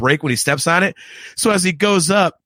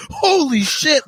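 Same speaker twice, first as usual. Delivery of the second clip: surprised exclamation; 9 semitones higher, slower than usual. Retold or imitated. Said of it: "he goes".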